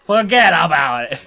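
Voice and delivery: put-on loud Brooklyn accent. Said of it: "Doing this Brooklyn accent".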